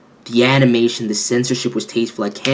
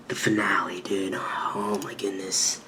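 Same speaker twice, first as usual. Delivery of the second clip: hushed, breathless whisper of awe; soft reverent whisper; awed whisper; 5 semitones lower, 13 dB quieter than usual. Real, not acted. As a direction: whisper it like you can't believe it's over.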